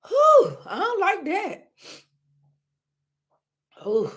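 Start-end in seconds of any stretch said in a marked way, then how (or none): 0.1-0.6 a real high, loud whew of relief; relieved exhale. Real, not acted. Streamer recovering from choking, relieved high whew. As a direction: blow out the breath after nearly choking.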